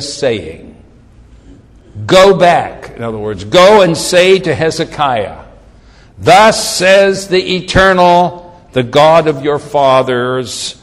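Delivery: stately prophetic reading, stepping down in pitch; scripture declamation. Acted. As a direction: proclaim God's message with long pauses. Reads God's word aloud.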